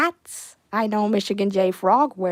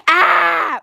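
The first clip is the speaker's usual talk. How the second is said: sudden yell; 5 semitones higher, 9 dB louder than usual. Real, not acted. Yells while reading chat.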